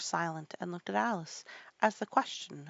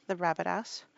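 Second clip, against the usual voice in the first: ordinary delivery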